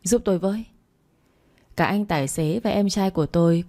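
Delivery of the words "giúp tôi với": mildly urgent call for help. A narrator's reading. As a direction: call out hastily, without shouting. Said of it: "vội kêu lên". (tiếng kêu gọi vội vã)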